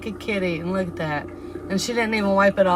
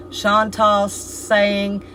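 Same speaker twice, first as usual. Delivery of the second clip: slow emphatic reading; 5 dB louder, slower than usual. Acted. Reading a chat comment aloud.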